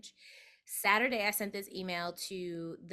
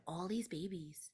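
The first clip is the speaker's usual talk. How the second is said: 1 hushed wonder; 7 dB quieter than usual. Real, not acted. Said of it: "dream babies appear".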